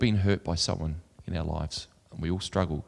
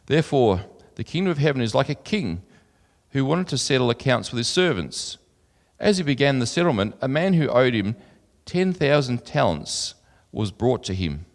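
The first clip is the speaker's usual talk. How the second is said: raised, carrying Bible reading voice; louder and higher than his own talk, like reading from the pulpit; scripture reading; 3 semitones higher, 4 dB louder than usual. Acted. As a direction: read the parable out to the congregation. Reads scripture aloud.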